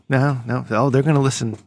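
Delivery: ordinary talk, nothing marked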